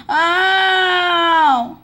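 high, loud mock pain cry. Kid crying out as his character gets hurt, loud ow. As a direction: cry out like you got hit.

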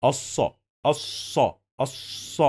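الص said "as-sa" with a lengthened s; doubled saad. Shown as a demonstration. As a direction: stretch the saad in الص across both syllables. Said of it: "here we have double صاد".

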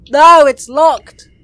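shouted, high and slow; panicked cry of dismay; breathless fright. Real, not acted. Exit door locked during chase.

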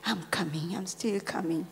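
low, near-whispered reverence; hushed, emotional preaching. Real, not acted. Pastor moved by Mary's love of God, hushed preaching. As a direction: pray and appeal in a very soft, low voice.

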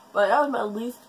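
normal talking voice

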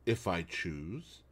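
cool, unbothered defiance; icy calm. A narrator's reading. Wife defying her husband, icy and quiet. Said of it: "Her tone did not change".

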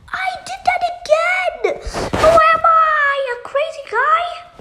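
loud, high frustrated cry; frustrated yell. Real, not acted. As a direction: shout it in disbelief. Dies again, yelling.

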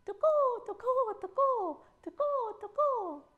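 high, loud tuko calls; gecko shouting its name. Acted. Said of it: "noisily shouted his name".

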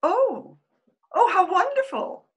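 delighted. Acted. Delighted by a telegram.

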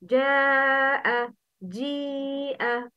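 slow, held mad vowels; مد واجب held four to five beats; long mad. Shown as a demonstration. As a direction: stretch the vowel before hamza. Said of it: "followed by همزة in the same word".

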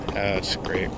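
usual speaking voice

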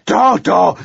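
very high, loud bellow upstairs; mother yelling up to her daughter. Acted. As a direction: bellow it at the ceiling. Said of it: "brüllte sie noch einmal".

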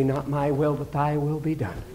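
low, soft, subdued prayer; quiet surrender. Acted. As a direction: say it humbly under the voice.

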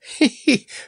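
high giggle; laughing. A narrator's reading. Clown laughing at the lion's new feathers, giggling. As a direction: break into a high-pitched laugh.

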